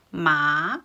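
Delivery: MA starting low and rising; second tone. Shown as a demonstration. Teacher showing how the voice rose, "Ma" in rising second tone. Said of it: "my voice rose a little bit".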